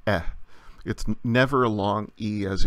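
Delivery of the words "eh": "eh" as the short vowel in edge; short E sound. Shown as a demonstration. Teacher demonstrating epsilon's sound, a short "eh" as in edge. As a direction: give a short, open "eh". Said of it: "always a short E as in edge".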